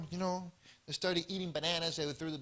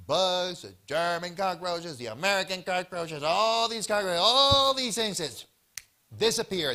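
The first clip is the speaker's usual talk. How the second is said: louder emphatic build; preaching the punchline louder; 7 dB louder than usual. Real, not acted. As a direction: drive the payoff home. Climax of the parable.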